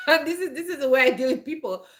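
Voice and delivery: laughing through the words; amused, laughing it out. Real, not acted. Laughing while explaining herself.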